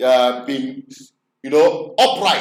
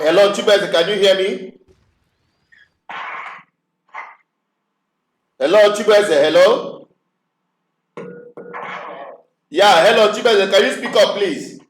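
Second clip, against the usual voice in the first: raised voice calling down a bad line; to someone hard of hearing; 3 semitones higher than usual. Real, not acted. Bad phone line, caller can't hear.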